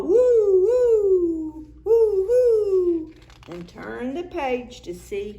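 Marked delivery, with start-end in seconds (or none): 0.0-3.1 high hooting calls, louder; loud high ghostly woo; loud owl-like call. Acted. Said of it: "Watch the sky and call".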